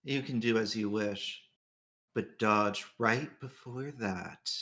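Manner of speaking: low, soft game-character voice; Archer voice. Acted. Player redoing the line as the right character, low soft voice. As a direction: drop low and quiet, slowing to the end. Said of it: "Jay can tell this is Archer talking".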